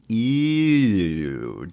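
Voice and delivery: long drawn-out catchphrase of disgust; Ernest. Retold or imitated. Ernest looking into a filthy toilet, drawn-out disgusted catchphrase. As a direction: stretch it out, sliding down in pitch. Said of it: "gives his catchphrase of".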